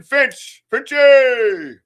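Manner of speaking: shouted name, high and loud; loud call. Real, not acted. Host greeting his friend with a big shout. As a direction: hail him across the room.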